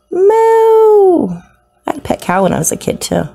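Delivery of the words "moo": playful moo. Pretend. Mooing at dairy cow isopods.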